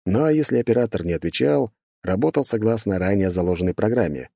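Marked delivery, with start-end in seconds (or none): none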